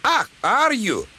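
high-pitched scornful retort; angry outburst. A narrator's reading. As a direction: burst out crossly, pitch right up. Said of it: "Donald burst out".

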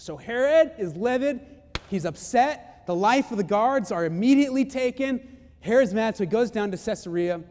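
forceful preacher telling of a ruler's anger; emphatic preaching. Real, not acted. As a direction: punch out each dramatic development.

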